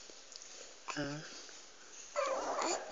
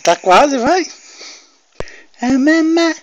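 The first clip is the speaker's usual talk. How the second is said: excited coaxing; 12 semitones higher, 13 dB louder, slower than usual. Real, not acted. Father coaxing baby's first word.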